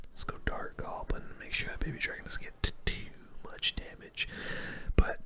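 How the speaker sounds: ordinary delivery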